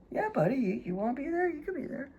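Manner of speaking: high, fading soft pet voice; talking to a dog. Real, not acted.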